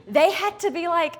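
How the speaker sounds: ordinary talk, nothing marked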